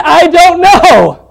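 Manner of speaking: hammed-up retelling of the customer's shrug; mocking clueless customer. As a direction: stretch it out slowly, imitating her blank reply. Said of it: "that is what she said".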